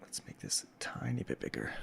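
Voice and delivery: breathy whisper to yourself; whispered aside. Real, not acted. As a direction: drop to a private whisper. Whispered aside while adjusting screen.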